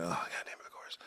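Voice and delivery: soft whispered groan; whispered resignation. Retold or imitated. Viewer muttering his exasperation to himself, whispered. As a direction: breathe it out like a defeated aside. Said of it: "I'm like".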